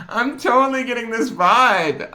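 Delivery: put-on high, loud, laughing valley girl. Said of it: "I sound like a valley girl".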